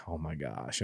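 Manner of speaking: a stunned, hushed oh my gosh. Retold or imitated. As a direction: let it fall out barely voiced in shock. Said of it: "I'm like".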